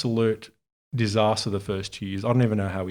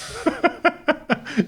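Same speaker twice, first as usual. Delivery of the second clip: hearty laugh. Real, not acted. Laughing at the memory of maxed-out credit cards, a high hearty laugh. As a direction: let a big laugh burst out high. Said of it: "I laugh at it now".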